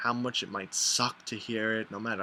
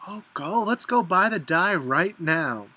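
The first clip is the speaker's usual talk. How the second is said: loud, high, drawn-out mock enthusiasm; egging a friend on; 6 semitones higher, 8 dB louder than usual. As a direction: play the over-eager friend, stretching the words. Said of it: "and you're like".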